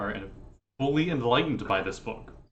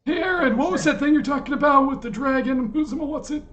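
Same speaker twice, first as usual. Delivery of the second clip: put-on high, loud wheezy old man; a doddering old man asking around; breathless old person; 14 semitones higher, 4 dB louder than usual. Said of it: "that wheezy old man laugh".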